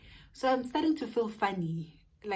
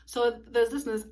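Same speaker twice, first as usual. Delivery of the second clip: ordinary talk, nothing marked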